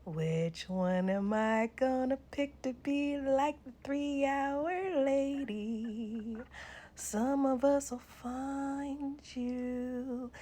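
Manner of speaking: slow, drawn-out eerie taunting voice; mocking menace. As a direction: stretch every word like a creepy stalker. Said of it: "I'll be mocking y'all".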